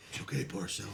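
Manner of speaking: hushed whisper, calming a friend; whispered comfort. Retold or imitated. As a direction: breathe it softly to reassure. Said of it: "He's like".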